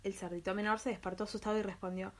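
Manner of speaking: usual speaking voice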